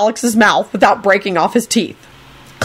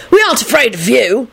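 defiant; 5 semitones higher than usual. Acted. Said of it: "he announced defiantly".

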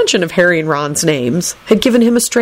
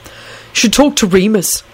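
slow, higher, pointed retort; nasty reply; 5 semitones higher, slower than usual. A narrator's reading. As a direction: answer with a touch of spite. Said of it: "Neville replied nastily".

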